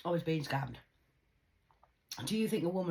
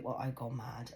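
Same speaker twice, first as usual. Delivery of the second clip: normal talking voice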